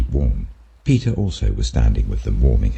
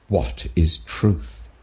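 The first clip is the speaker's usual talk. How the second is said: slow, soft dismissive retort; a slow, softer, lightly voiced Pilate retort; 4 semitones higher, 4 dB quieter, much slower than usual. A narrator's reading. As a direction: let the question fall away slowly and quietly. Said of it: "retorted Pilate".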